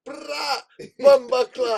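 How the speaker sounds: high, loud mock Jamaican yell; Jamaican accent. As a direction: shout it in a put-on Jamaican accent. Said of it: "do more Jamaican accents".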